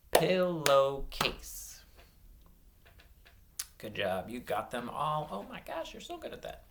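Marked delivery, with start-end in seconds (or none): 0.1-1.8 PILLOWCASE slowly, a gap after every syllable. Shown as a demonstration. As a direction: clap out "Pillowcase" piece by piece. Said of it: "clap out some syllables".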